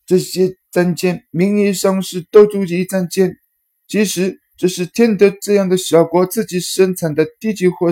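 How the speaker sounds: high, scornful character voice; disdainful high voice. A narrator's reading. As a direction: look down on it, sneering. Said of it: "鄙夷地说道".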